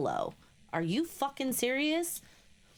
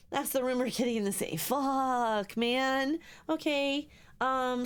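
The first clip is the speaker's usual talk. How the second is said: ordinary talk, nothing marked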